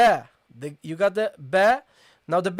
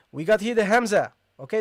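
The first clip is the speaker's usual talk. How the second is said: ordinary talk, nothing marked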